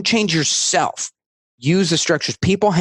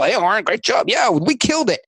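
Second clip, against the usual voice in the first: higher-pitched mock backslapping; self-congratulatory cheer; 6 semitones higher than usual. Colleagues congratulating each other on their own work, mock excited. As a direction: put on a high, pleased team cheer.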